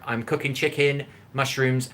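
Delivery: ordinary talk, nothing marked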